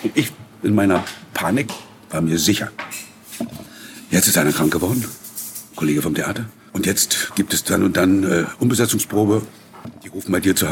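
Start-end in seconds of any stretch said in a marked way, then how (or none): none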